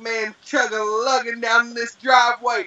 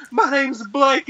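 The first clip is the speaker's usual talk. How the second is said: freaking out; 7 semitones higher, slower than usual. Retold or imitated. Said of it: "I'm freaking out".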